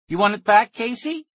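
high, loud mocking tease; grinning taunt. A narrator's reading. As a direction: sneer it out with a grin. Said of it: "he said, grinning".

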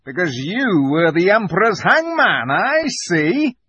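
high, loud scornful beaver voice; indignant beaver. A narrator's reading. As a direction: jeer as an outspoken beaver. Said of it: "said Mister Beaver".

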